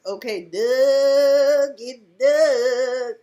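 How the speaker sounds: slow loud mocking call of Doug; mocking drawl. Real, not acted. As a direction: stretch the name out mockingly. Mocking drawn-out name.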